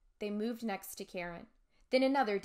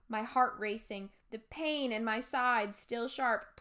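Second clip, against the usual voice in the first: ordinary delivery, nothing marked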